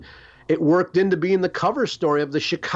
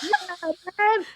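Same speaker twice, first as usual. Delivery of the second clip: doorman greeting. Retold or imitated. Imitating the blues club doorman.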